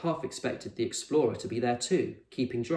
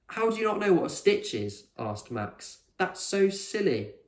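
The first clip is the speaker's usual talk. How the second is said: put-on childish disbelief; 8 semitones higher than usual. A narrator's reading. Max scoffing at Con, incredulous. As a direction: tease her a little.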